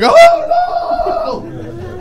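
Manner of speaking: very high panicked wail; drawn-out comic shriek; startled scream. Retold or imitated. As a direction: wake up screaming in mock terror. Woken by grandmother's screaming.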